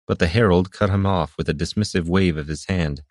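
ordinary delivery

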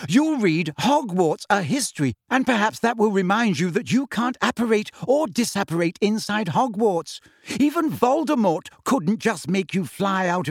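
put-on high, exasperated lecturing girl. A narrator's reading. Hermione scolding Harry, exasperated and high. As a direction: scold him as if it were obvious. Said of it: "sounding thoroughly exasperated".

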